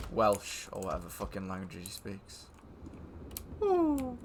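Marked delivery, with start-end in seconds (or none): 3.6-4.2 much higher, slow, sing-song mocking coo; silly high voice. Gamer teasing friend about playing with James, high mocking coo. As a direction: coo it like a tease winding up a mate.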